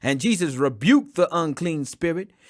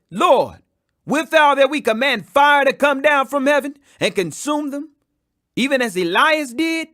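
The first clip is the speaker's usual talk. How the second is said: a touch of eager indignation, higher and louder; indignant disciples; 7 semitones higher, 6 dB louder than usual. Acted. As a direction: urge the Lord to call down fire. Disciples ask to call fire.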